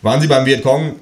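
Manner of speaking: a hint of a pushy policeman; police officer. Police at the door asking to come in, lightly voiced officer.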